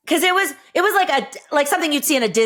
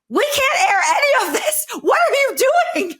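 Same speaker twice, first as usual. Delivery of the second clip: mock-panicked yell through laughter, much higher; laughing shout; 11 semitones higher than usual. Cameramen yelling at a contestant to stop, laughing shout. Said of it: "the cameramen ... were like".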